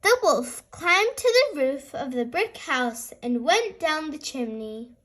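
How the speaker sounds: usual speaking voice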